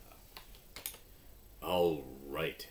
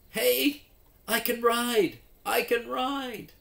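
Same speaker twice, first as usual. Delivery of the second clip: much higher and louder, bursting with joy; thrilled; 11 dB louder than usual. Acted. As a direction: cheer like a kid who finally made it. Mike finally rides.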